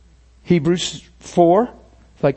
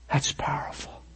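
awed whisper; 2 semitones lower, 11 dB quieter than usual. Real, not acted. Moved remark after testimony.

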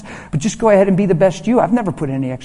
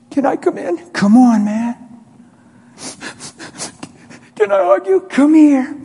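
high, strained emotional voice; acted overwhelmed, high-pitched welcome; choked-up plea; 10 semitones higher, 4 dB louder than usual. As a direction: plead as if fighting back tears. Emotional father greeting his crying son.

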